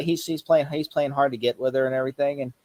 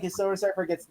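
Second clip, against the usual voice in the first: ordinary talk, nothing marked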